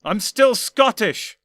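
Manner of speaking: incredulous loud outcry; cried incredulously. Acted. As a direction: shout it out in shock. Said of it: "he cried incredulously".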